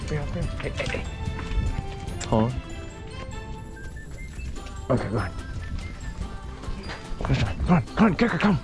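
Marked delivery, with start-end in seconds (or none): none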